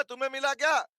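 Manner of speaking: ordinary talk, nothing marked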